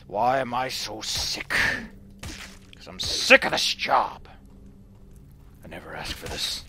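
low, slow growly grumble; put-on low, miserable complaining voice; pissed-off character. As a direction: groan it out slowly, fed up. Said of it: "he's so pissed. He's just like".

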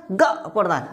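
usual speaking voice

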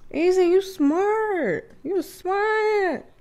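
very high, squealing voice; awed excitement. Real, not acted. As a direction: squeal with delight as it clicks. Thrilled at working out the meaning.

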